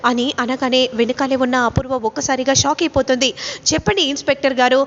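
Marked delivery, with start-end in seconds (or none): none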